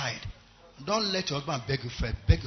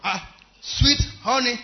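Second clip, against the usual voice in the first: slow, high, sugary endearment; husband sweetly greeting his wife at the door; 8 semitones higher, 6 dB louder, slower than usual. Acted. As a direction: draw it out lovingly to the wife. Husband greets wife after work.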